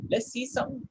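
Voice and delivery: normal talking voice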